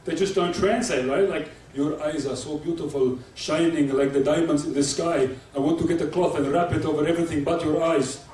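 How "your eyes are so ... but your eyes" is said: put-on Arabic accent. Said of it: "Happens with Arabic people".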